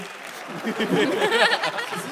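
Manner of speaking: loud high laugh; real laugh after a joke. Real, not acted. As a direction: burst out laughing.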